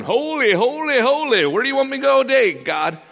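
high, loud mock-angel chant; angels crying holy. Angels calling out to God beside his throne, high and loud. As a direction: pitch it way up and belt it, then rush the question.

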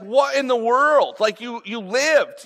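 high, slow, incredulous outburst; freaked out. Acted. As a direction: act stunned, stretching the words out. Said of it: "so freaked out by this".

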